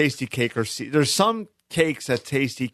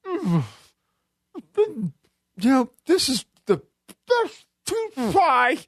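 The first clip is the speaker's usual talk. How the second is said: gloating pie eater; 14 semitones higher than usual. Retold or imitated. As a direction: savour every word to rub it in. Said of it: "he's going like this".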